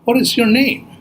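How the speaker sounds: high-pitched storybook bird; high bird voice asking a question; shorebird. Acted. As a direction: voice a curious little bird, pitched well up. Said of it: "the shorebirds looked at her and said".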